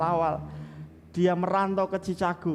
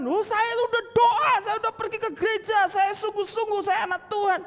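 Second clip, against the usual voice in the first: much higher and louder indignant protest; angry protest; 5 dB louder than usual. Retold or imitated. Young man hurt, snapping back at his friend in anger. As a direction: fire it back, offended and fast. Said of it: "Dia marah".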